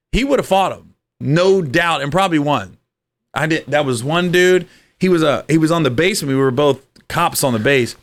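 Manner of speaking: usual speaking voice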